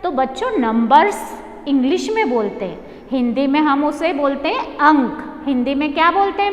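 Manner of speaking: usual speaking voice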